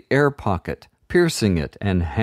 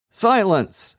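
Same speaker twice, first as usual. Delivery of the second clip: much higher commanding shout; 11 semitones higher than usual. A narrator's reading. Enchanter shouting the children down. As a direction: silence the room. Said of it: "shouted the enchanter".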